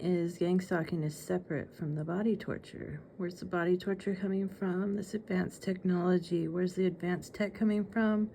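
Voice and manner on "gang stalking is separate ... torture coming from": ordinary talk, nothing marked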